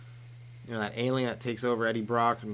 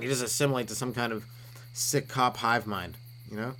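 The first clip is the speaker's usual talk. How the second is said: usual speaking voice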